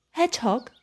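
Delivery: plain reading voice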